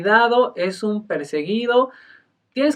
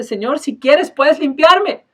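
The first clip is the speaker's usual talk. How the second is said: a touch of a higher, louder plea; pleading cry; 8 semitones higher, 8 dB louder, slower than usual. Retold or imitated. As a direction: beg the Lord from the heart. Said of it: "con todo el clamor de su corazón". (súplica algo más alta y fuerte)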